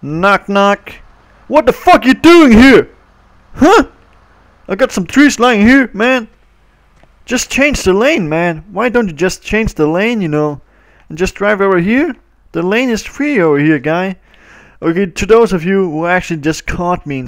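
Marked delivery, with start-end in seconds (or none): 0.0-0.9 higher put-on voice knocking; a cheeky pretend knock at the car window; mock knock. Kid playing driver knocks on the car, put-on voice. 1.5-3.9 high, loud mock road rage; put-on angry voice. Kid in a game yells at a stuck driver, high put-on rage. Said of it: "turn off the the voice". 4.7-14.2 higher, louder mock driver rant; put-on angry driver voice. Kid voicing an annoyed driver in a game, hammed-up rant. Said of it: "turn off the the voice".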